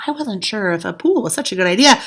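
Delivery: plain reading voice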